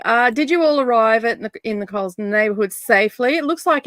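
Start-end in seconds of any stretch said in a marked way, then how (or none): none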